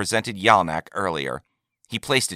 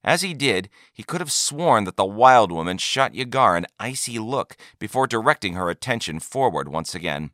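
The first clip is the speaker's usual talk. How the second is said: plain reading voice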